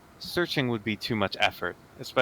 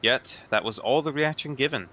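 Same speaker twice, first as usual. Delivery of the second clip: normal talking voice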